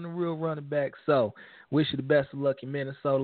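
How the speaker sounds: ordinary talk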